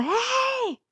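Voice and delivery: high, drawn-out call without full volume; mock yell. Swimmer calls her lost kayaker in the dark, mock yell. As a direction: imitate calling across the sea, high and held. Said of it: "Y yo le gritaba".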